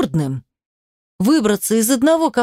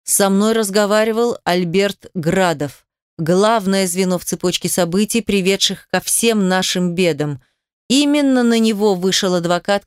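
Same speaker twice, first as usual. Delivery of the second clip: ordinary delivery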